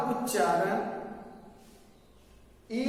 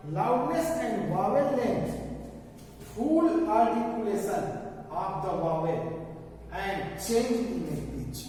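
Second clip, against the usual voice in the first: reading the definition out word by word, very slowly; slow dictation reading; much slower than usual. Acted. Teacher reads the English definition slowly to the class, dictating. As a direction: spell out the textbook line for students.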